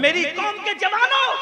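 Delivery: high, loud summons; rousing call. Real, not acted. Preacher summoning the youth of his community, high loud appeal. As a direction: call out to the whole crowd.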